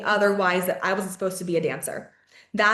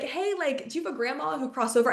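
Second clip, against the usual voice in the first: eager pitch; 7 semitones higher, 3 dB quieter than usual. Retold or imitated. Mimicking her eager mall pitch.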